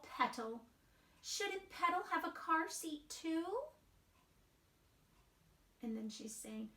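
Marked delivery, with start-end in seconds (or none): 1.2-3.6 put-on high small child voice; little mouse voice. Wemberly peeking at her parents, high and timid. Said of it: "she worried about her doll".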